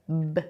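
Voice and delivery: B as a lip-pop "buh"; short, isolated "buh" for B; consonant sound B. Shown as a demonstration. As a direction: say B as its short popped sound, not the letter name. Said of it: "lips together and then you pop them open".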